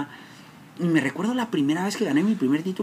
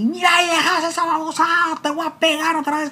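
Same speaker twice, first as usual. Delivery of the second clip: much higher, louder threatening father; father's threat; 4 dB louder than usual. Retold or imitated. Father threatening to hit his son again. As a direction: threaten to beat him again. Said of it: "me dice".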